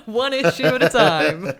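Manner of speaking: laughing through the words, slow. Real, not acted. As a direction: giggle it out, drawn out. Laughing tease about Jamie.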